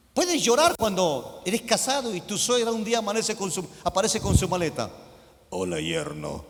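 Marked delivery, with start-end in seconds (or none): 5.5-6.4 much lower, mock stern mother-in-law. Mother-in-law greeting her son-in-law, low mock voice. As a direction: play the unwelcome mother-in-law.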